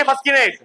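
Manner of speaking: "फस्किनेट" with k sounded after s; wrong pronunciation. Shown as a demonstration. Teacher asks class if it reads so, "फस्किनेट" with a k. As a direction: say "फस्किनेट" high, as fas-ki-net. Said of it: "नहीं पढ़ेंगे फस्किनेट".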